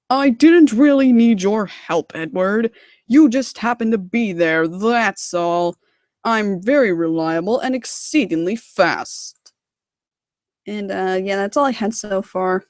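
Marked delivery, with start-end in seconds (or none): 0.1-9.5 slow, pompous engine voice with big swoops; Gordon. Acted. Gordon answering Edward in the shed, huffy and pompous. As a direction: retort haughtily, drawing the words out. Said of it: "Gordon claims to be fast and reliable".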